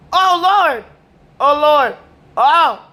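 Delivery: high, loud oh lord; excited exclaim. Real, not acted. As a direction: blurt it out high and loud in disbelief. Reacting loudly to a video.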